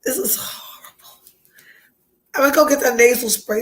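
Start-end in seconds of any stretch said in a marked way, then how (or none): 0.0-1.2 broken words between long tearful pauses; really crying, struggling to get it out; homesick crying. Real, not acted. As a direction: pitch jumping, then collapsing into whisper. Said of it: "I'm homesick".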